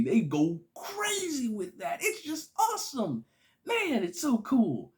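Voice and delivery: high-pitched, drawn-out fan rave; gushing excitement. Real, not acted. Fan raving about the mirror fight scene, gushing.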